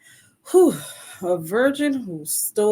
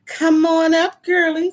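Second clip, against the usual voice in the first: excited call-in; 9 semitones higher, 7 dB louder than usual. Real, not acted. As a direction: stress on Come, voice rising high and loud. Host calling guest on stage.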